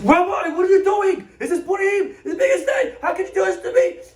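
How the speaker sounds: very high, loud yelling protest; acted screaming. Shopkeeper dragged to a cell protests to rabbi, screaming. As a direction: scream it in full outrage. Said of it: "he's screaming and he's yelling".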